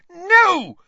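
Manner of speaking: high, very loud cry of refusal; screaming. A narrator's reading. Hermione cutting off Lupin's explanation, screaming. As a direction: scream to stop him. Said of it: "Hermione screamed".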